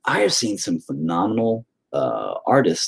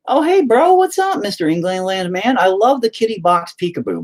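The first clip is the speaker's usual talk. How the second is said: much higher and louder, a hammed-up dude-bro greeting; mock bro voice; 12 semitones higher, 4 dB louder than usual. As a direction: play a goofy excited bro reading the chat. Reading a viewer's chat comment aloud.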